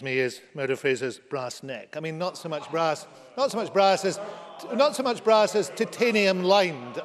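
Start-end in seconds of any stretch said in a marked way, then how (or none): 3.4-7.0 louder and higher, the last take slowed right down; rising shout. Real, not acted. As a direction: build the jab into a near shout, dragging out "titanium lined". Building repeated jab at opponent.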